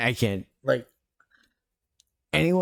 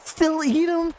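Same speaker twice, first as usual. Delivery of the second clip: squeaky high voice. Pretend. Squeaky high joke question.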